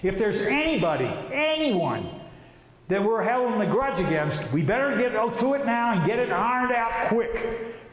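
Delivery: normal talking voice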